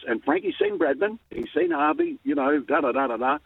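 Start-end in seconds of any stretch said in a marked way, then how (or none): none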